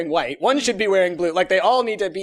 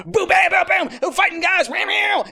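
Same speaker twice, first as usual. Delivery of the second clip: excited shouting; 12 semitones higher, 5 dB louder than usual. Real, not acted. Hyped recap of action movie.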